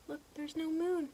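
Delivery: ordinary delivery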